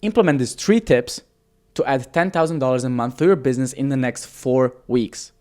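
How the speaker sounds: ordinary delivery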